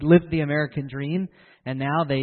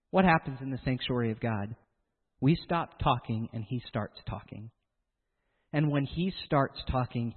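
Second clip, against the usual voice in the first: usual speaking voice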